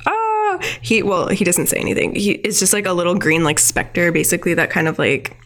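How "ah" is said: spirit wail. Retold or imitated. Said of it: "the spirit is like".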